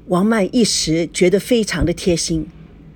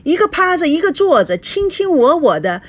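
higher-pitched, indignant wife; angry confrontation; 7 semitones higher than usual. A narrator's reading. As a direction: pile up accusing questions, voice climbing. Wife confronting husband in quarrel. (气愤地质问)

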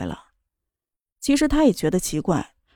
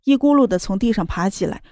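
plain reading voice

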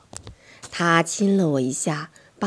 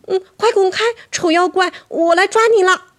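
又高又响的孩子气喊声; 拔高嗓门冲着妖怪喊，吓跑它; 12 semitones higher, 5 dB louder than usual. A narrator's reading. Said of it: "我也喊道". (高声叫喊)

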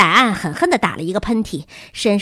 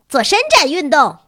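high, loud call; shouted demand; 7 semitones higher, 6 dB louder than usual. A narrator's reading. Pupil adding fuel to the fire, shouted. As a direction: pile on with an eager yell.

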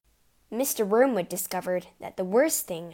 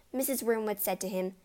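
ordinary delivery

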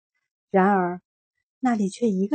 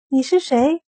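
startled question; 5 semitones higher, 6 dB louder than usual. A narrator's reading. Friends ask in shock.

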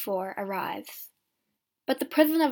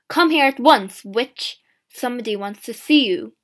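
louder barked order; loud rough call; 11 dB louder than usual. Acted. Guard yelling at a prisoner to come, loud. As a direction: order her out, loudly. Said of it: "the guard calls".

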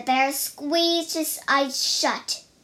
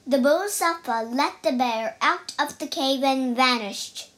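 ordinary delivery

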